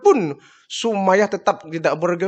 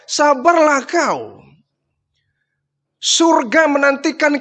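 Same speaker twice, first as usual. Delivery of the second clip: shouting encouragement; 7 semitones higher than usual. Acted. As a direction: shout hope to them from a distance. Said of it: "beliau teriak".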